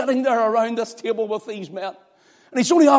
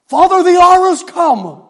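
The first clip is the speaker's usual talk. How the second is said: anguished cry; 11 semitones higher, 13 dB louder than usual. Acted. Jesus crying out in prayer.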